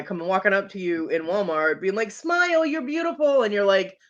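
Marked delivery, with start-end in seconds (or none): 2.1-3.4 high-pitched mock drunk come-on; obnoxious drunk guy. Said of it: "really loud, obnoxious drunk guy".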